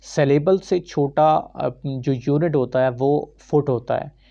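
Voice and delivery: normal talking voice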